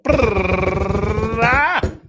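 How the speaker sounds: high, loud rolled-lip drum roll; mock drum roll before revealing the numbers; mouth drum roll. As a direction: buzz your lips like a snare drum roll. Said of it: "Drum roll".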